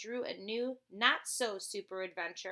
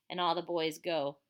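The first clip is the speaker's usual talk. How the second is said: ordinary delivery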